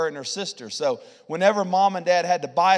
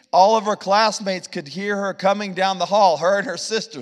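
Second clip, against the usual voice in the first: ordinary talk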